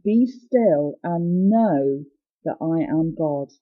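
drawn out very slowly, voice sinking at the end; slow solemn delivery. Shown as a demonstration. Preacher voicing God's command to listeners, slow and hushed. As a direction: let each word of God's command settle slowly. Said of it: "God is saying".

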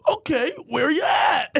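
high, loud, drawn-out mock cop; hammed-up police dispatcher shout; cop on the phone. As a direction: holler it slowly like an exasperated cop. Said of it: "then the guy's like".

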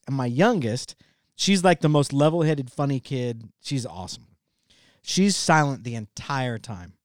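usual speaking voice

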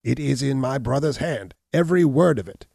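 loud, high outburst; defiant cry. A narrator's reading. Hunchback denying forgery to Abner, loud defiant cry. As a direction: fling the denial back hard. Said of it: "cried the hunchback".

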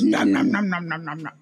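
imitating a chewing nom sound. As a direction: chomp it out like a cartoon eater. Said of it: "he did the audio that goes".

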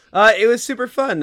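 bright, bubbly interview answer; excited answer. Pretend. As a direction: gush like a happy guest. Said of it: "let's role play".

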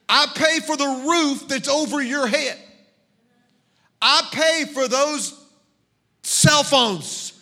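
loud, high stern dad scolding; stern lecture. Retold or imitated. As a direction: lay down the law to the kids.

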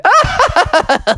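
high staccato cackle; hammed-up high chuckle; character laugh. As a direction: laugh out in a string of high ha-ha bursts. Mister Toad takes the ball.

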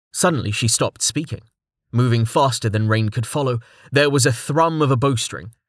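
ordinary delivery

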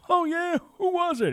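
high squeaky cartoon voice asking a question; squeaky alter ego. Talking to himself as a squeaky sidekick, high squeaky voice. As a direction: pitch way up into a silly squeak.

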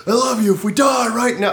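high, loud mock declaration of love; mock shout. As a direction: shout it like the car is about to crash. Intense lover talk in car.

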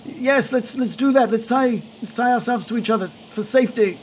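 high, acted shout of agreement; yelling over wind. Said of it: "yell, yell over the wind".

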